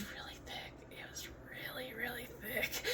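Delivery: hushed, whispered admission; confiding whisper. Real, not acted. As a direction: drop to a near-silent whisper. Confiding how thick accent was.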